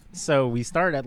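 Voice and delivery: ordinary talk, nothing marked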